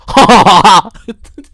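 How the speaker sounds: hammed-up villain cackle; imitated Liv Morgan laugh. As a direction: cackle like the smug wrestler. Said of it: "That's how she laughs".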